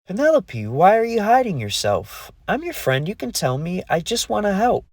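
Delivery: high, gentle pleading fairy voice; caring fairy. A narrator's reading. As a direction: coax a friend out of hiding. Lee comforting hidden friend.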